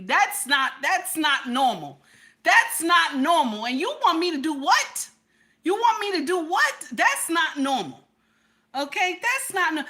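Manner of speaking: loud, high, emphatic disbelief; worked-up protest. Real, not acted. Woman telling viewers her man's whispering isn't normal, worked up. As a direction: protest it loudly, overwhelmed.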